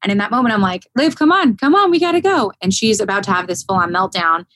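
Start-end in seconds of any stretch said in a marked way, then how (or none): 1.0-2.5 put-on high-pitched, insistent urging of a little girl to keep walking; urging child on. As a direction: come on, come on, we have to go. Urging scared daughter to walk.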